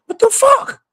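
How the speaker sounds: very high disbelieving squeal; shocked squeal. Real, not acted. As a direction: squeak out the outrage. Outraged at food prices.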